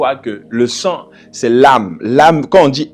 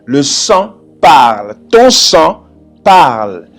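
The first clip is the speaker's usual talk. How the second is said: slow, forceful declamation, key words stretched and punched; emphatic preaching; 4 dB louder than usual. Real, not acted. Preacher telling believers their blood speaks, insistent forceful preaching. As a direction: drive the repeated phrase home to the congregation.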